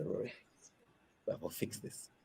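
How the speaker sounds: hushed, almost whispered plea; a worn-out whisper to a friend; quiet plea. Retold or imitated. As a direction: breathe it out in despair. Pleading to Rory at dawn.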